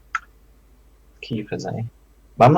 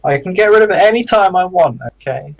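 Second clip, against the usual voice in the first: defensive; 7 semitones higher, 4 dB louder than usual. Acted. Defensive about sword.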